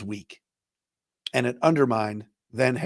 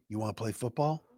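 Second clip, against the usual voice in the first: mock leaning-in question; 4 semitones lower, 11 dB quieter than usual. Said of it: "is leaning in going".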